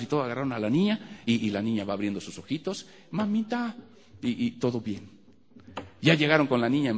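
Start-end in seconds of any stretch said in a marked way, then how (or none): 3.1-3.8 put-on high little-girl call; child calling mom. Little girl waking up and calling for her mother, high.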